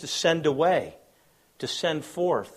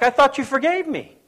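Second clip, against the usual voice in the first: hurt retort; 11 semitones higher, 7 dB louder than usual. Retold or imitated. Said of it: "And it's like".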